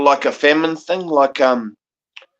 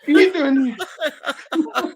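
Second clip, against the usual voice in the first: mock outraged greeter; 13 semitones higher, much slower than usual. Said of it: "They're like".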